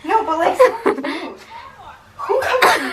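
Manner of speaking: high laughing protest. Real, not acted. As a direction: laugh while complaining. Laughing complaint.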